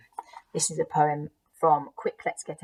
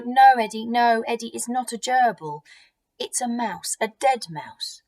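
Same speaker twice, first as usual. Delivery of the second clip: raised, higher protesting voice; exasperated parent; 7 semitones higher, 7 dB louder than usual. Acted. Dad telling Eddie it's not a gerbil, exasperated. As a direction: correct the child urgently, louder at first. Dad corrects toddler holding dead mouse.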